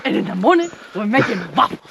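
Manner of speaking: loud mock Donkey voice; imitated donkey impression. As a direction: mimic a friend's loud donkey act. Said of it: "You're like".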